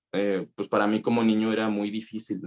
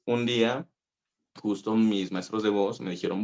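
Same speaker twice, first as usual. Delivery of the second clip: normal talking voice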